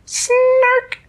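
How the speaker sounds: very high, loud creature squeal; snark call. Acted. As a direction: make a sudden piercing little creature cry. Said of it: "and it said".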